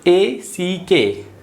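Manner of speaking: very slow letter-by-letter spelling; spelled out slowly. Shown as a demonstration. Teacher to pupils, slowly spelling out the letters. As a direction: stretch out each letter for the class. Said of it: "अगला है स्न".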